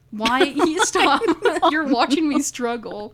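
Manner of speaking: loud, high protest through laughter; laughing protest. Real, not acted. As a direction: crack up while telling them to stop. Cracking up while stumbling over reading.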